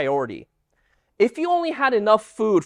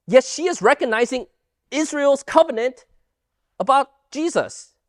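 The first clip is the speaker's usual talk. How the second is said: usual speaking voice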